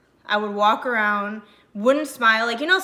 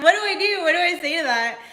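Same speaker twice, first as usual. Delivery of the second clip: high, loud mock panic; flustered retelling; 8 semitones higher, 6 dB louder, faster than usual. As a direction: blurt it out, amused and at a loss. Retells own flustered reaction, laughing.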